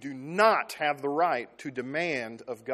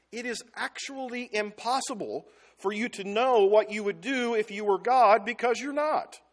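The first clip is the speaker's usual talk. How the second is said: ordinary talk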